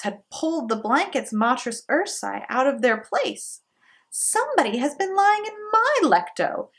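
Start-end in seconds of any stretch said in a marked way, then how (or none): 4.1-6.7 high mother bear voice; the mama bear, pitched well up; mama bear's high middle voice; middle-sized voice. A narrator's reading. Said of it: "in her middle-sized voice".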